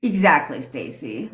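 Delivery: usual speaking voice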